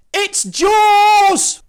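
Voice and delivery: high, loud cry of Jaws; panicked shout. Acted. Frightened disciple shouts his next wild guess, high shout. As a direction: blurt the guess in a terrified shriek.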